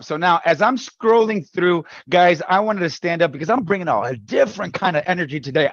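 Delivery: ordinary talk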